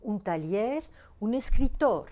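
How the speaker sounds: taller and escritor stressed on LLER and TOR; Spanish "escritor" with a strong final tor; stress on last syllable. Shown as a demonstration. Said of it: "l'accent tonique sur la dernière syllabe".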